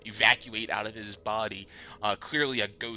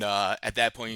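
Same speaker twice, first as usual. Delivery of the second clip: normal talking voice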